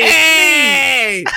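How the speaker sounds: long, high Italian-style "hey"; hammed-up Italian shout; Italian accent. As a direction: holler it like a cartoon Italian. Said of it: "do an Italian accent".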